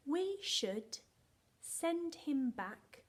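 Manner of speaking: slow, chunked words with pauses; deliberate model reading for children to echo; say-along dictation. Shown as a demonstration. Teacher repeats the sentence with the class, slowly and clearly.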